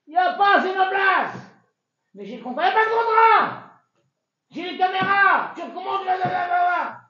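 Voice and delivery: high, loud heated yelling; heated argument. Acted. Landlord and son-in-law shouting over the parking spot, heated. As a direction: fight over the spot at full volume.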